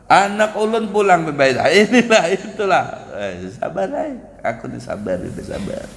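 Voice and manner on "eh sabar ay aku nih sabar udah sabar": quiet patience. Acted. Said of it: "sampai kapanpun tetap aku sabar".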